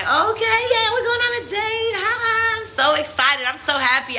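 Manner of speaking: sing-song high giddy voice, mocking her past self; mock giddy excitement. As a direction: play the naive girlfriend thrilled about the date. Mocking her own naive excitement.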